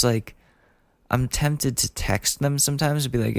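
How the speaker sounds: ordinary talk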